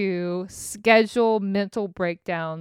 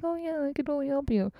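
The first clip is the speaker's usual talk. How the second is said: high, soft, fast chirpy offer; imitating how eagerly she volunteered; mock eager self; 6 semitones higher, 5 dB quieter, faster than usual. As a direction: mimic her past overeager self. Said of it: "I was like".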